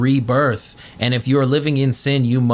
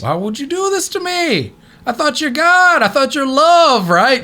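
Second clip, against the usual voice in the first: betrayed believer; 11 semitones higher than usual. Acted. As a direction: plead with God as if betrayed. Voicing betrayed devotee.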